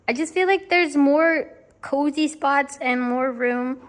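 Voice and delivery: talking through hiccups; hiccupping between words. Real, not acted. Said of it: "Sorry, I have the hiccups".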